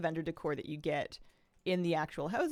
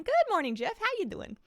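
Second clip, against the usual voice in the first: high greeting voice; 4 dB louder than usual. Acted. Greeting a game character.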